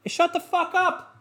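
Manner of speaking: high, slow snapped order at the barking dog; yelling at the dog. Real, not acted. Owner yelling at his barking dog mid-recording, high angry order. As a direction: cut the dog off right now.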